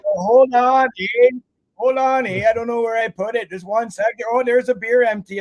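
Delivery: high, loud, flustered Canadian "eh"; hammed-up Canadian. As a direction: play a flustered Canadian digging for a passport. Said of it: "you gotta get a couple more of those A's in there".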